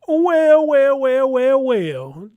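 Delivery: loud, high drawn-out well well well; excited greeting. Real, not acted. Streamer greeting a returning viewer, loud and delighted. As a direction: hail a friend who just showed up.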